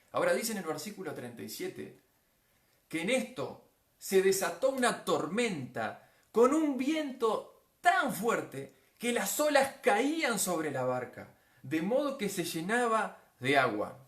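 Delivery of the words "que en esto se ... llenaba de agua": loud, slow, high-pitched swells; dramatic storm reading. Acted. Preacher reading the congregation the storm verse, dramatic. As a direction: draw out the storm with booming force.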